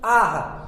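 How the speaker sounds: "आह" as long aa ending in a light h; visarga pronunciation. Shown as a demonstration. Teacher showing visarga after long aa, pronounced demonstration. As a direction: say "आह" with aa released into a half h. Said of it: "हम क्या पढ़ते हैं?".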